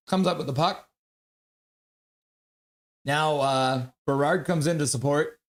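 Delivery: brisk hockey commentary, calling each pass; live play-by-play. Acted. Streamer calling his hockey game for chat, play-by-play voice. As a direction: call the game as it happens, quick and steady.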